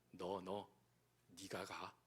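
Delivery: a deep, hushed divine command; God's command. Acted. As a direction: press it down low and quiet like God. God pointing at the believer.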